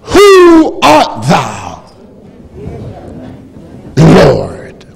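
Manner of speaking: high, slow cry of a stunned man; Saul crying out. Acted. Saul on the road, crying out to the Lord in awe. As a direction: cry the question up to heaven.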